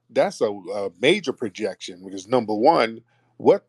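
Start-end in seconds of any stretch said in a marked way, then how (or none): none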